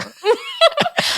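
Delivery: high squealing laugh; burst of laughter. Real, not acted. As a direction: crack up at the punchline. Laughing at mom's joke.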